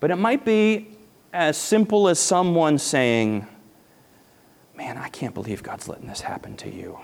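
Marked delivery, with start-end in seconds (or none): 4.8-7.0 soft sympathetic aside. Retold or imitated. Someone's sympathetic remark.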